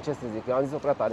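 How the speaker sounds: normal talking voice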